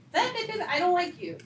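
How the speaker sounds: high, loud mock pout; childish mock complaint; mock childish whining. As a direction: whine it like a petty grudge. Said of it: "This is not me just making".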